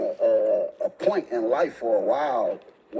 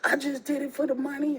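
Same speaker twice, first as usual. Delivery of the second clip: put-on higher, small sheepish girlfriend voice; girlfriend's excuse; 13 semitones higher than usual. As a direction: mumble the excuse like she's caught.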